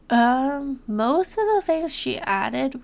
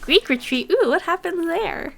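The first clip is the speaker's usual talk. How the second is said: excited wonder; 5 semitones higher, 6 dB louder than usual. Retold or imitated. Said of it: "I was like".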